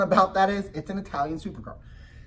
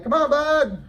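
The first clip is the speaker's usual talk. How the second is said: put-on higher, louder call to the kid; 9 semitones higher, 6 dB louder, slower than usual. Dad calling his son toward the ride, raised high call. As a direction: wave him along, voice raised and high.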